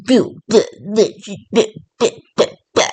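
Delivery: scornful blah-blah, half sung; mocking blah blah. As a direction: brush the judgement off with a mocking babble. Dismissive mock judgement.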